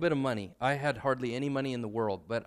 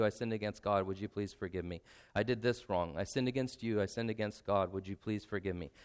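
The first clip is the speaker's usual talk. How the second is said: soft, humble, lowered voice; sincere quiet apology; 4 semitones lower, 5 dB quieter than usual. Shown as a demonstration. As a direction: apologise gently, meaning every word. Husband apologising to his wife.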